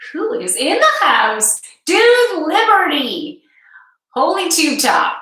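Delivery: high, loud and delighted; light up as a friend walks in; bright gushing hello to a viewer; excited greeting. Real, not acted.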